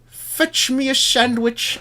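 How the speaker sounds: high, slow demanding voice; high, slow bossy voice; mock bossy demand. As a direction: drawl out the order in a high mock voice. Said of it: "you're like".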